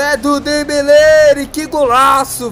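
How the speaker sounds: shouting a goal call, slow and high; explosive goal commentary. Real, not acted. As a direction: belt out the goal like a TV announcer. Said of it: "explosive goal commentary".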